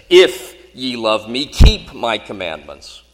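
"If" and "keep" punched loud; stress on IF and KEEP. Shown as a demonstration. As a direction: hit "If" hard, then a slow, loud "keep". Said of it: "emphasizing the words in different fashions".

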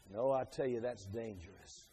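ordinary talk, nothing marked

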